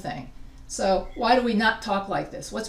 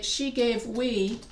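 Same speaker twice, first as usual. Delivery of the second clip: slow wrong example. Shown as a demonstration. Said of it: "I also see people say".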